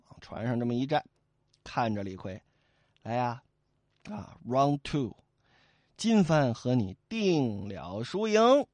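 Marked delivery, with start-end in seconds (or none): 3.0-8.7 low, slow, smug dare; cool taunt. Acted. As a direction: invite him to round two. Boatman taunts mid-river. (低沉慢悠悠地挑衅)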